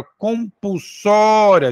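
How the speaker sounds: "compulsória" with a long, emphatic "só"; stressed syllable drawn out. Shown as a demonstration. Teacher asking for attention, "compulsória" with "só" held long. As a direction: stretch the "só" of "compulsória". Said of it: "Se você for separar".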